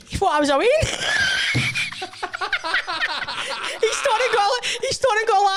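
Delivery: laughing at the punchline; laughing shout. Real, not acted. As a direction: blurt it out while laughing. Laughing while telling the punchline.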